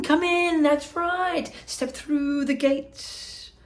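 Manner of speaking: high, slow, gushing host; excited Wonka. Acted. Wonka greeting Augustus and his parents, high and gushing. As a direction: gush over the boy like a thrilled showman. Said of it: "cried Mister Wonka".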